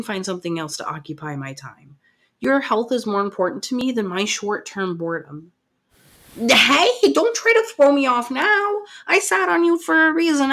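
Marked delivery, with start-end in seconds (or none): 6.4-10.5 louder, higher, teasing and firm; playful firm scolding. Acted. Girlfriend stops her boyfriend escaping the massage, louder, playfully firm. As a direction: pin him down with a laughing warning.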